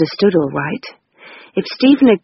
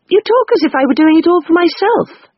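higher, hurt and defensive; Rose's voice; 11 semitones higher than usual. Acted. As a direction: cut in on her sister. Rose breaking in.

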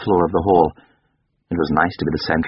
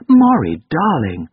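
high, loud call falling to an endearment; alarmed mother; 9 semitones higher, 5 dB louder, slower than usual. A narrator's reading. Mother interrupted by her son's sob, alarmed. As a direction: cry out the name, then ease down.

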